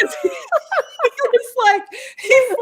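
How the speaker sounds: laughing so hard the words crack; breaking into giggles mid-sentence. Real, not acted. Laughing while telling the story.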